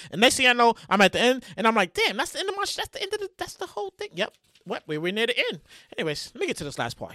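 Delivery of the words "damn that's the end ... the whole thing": high, hushed aside; hushed self-quote in a raised voice; quiet mock dismay. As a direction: say it small and high, fading softer. Quotes own surprise at reaching the end.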